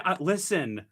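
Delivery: usual speaking voice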